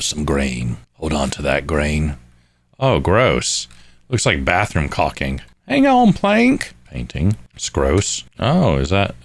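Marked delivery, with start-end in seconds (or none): 5.7-6.7 silly high call. Acted.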